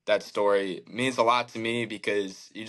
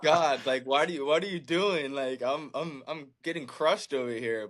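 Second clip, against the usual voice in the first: higher, laughing through a half-joking plea to God; laughing mock complaint; 5 semitones higher than usual. Pitcher laughing as he retells his complaint to God, higher mock plea. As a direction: let the laugh spill into a mock protest, pitched up.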